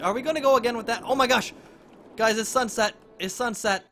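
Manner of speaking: loud, high, excited burst; excited streamer spotting the sunset. Real, not acted. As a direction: gasp it out thrilled.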